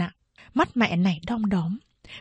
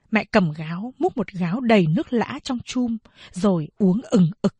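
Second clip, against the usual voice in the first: ordinary delivery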